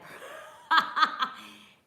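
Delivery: high airy burst of laughter; laughing. Real, not acted. Streamer laughing at a viewer's goodnight, high giggle.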